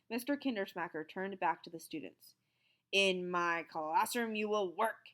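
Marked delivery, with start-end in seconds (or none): 2.9-5.1 loud, slow barked order. A narrator's reading. Stern teacher barking at the students, slow and loud. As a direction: bark it at the class. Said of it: "he barked".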